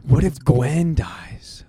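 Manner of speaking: slow breathy whisper; gasping whisper. Real, not acted. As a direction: gasp and whisper it. Gasped sudden worry.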